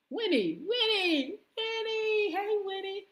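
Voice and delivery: playful calling out to a friend; sing-song call. Real, not acted. Calling out to a viewer.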